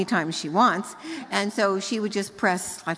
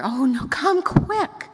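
higher, slow imitation of an urgent toddler; mock child voice; 5 semitones higher, 5 dB louder, slower than usual. Grandmother imitating granddaughter on phone, light childish plea. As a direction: play the little girl begging, lightly. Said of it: "And I went".

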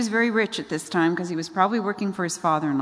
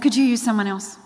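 mild mock panic, raised pitch and volume; freaking out; 5 semitones higher, 5 dB louder than usual. Moses begs God to pick someone else, mildly panicked. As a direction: plead to be let off, lightly acted. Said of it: "he freaks out".

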